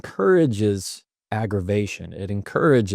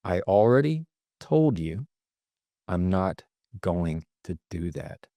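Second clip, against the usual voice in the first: slow, deliberate, pausing midway, ending softer; measured, unhurried firmness; fully in control; 3 dB quieter than usual. Shown as a demonstration. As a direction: space the words out and settle downward. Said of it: "The other says, "I am fully in control".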